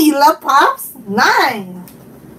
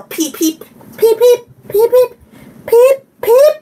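peeping chick; 8 semitones higher than usual. Pretend. Imitating a toy chick.

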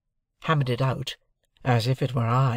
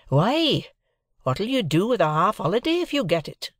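higher-pitched, swooping questioning voice; Joe the blacksmith; 8 semitones higher than usual. A narrator's reading. Blacksmith challenges his journeyman over a half holiday, questioning. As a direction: ask it with big rising swoops.

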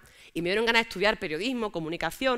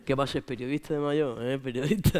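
ordinary talk, nothing marked

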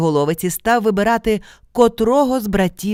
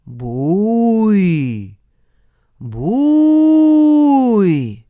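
long howled calls, the second far higher and louder; distant creature call; 5 semitones higher, 8 dB louder than usual. Acted. Dog-headed ogre calling through the forest at night, drawn-out howl. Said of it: "хтось в лісі кричить".

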